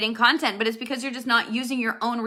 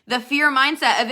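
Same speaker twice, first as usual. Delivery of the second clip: normal talking voice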